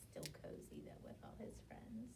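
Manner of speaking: soft cozy whisper; cozy hushed whisper. Real, not acted. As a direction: whisper it warmly.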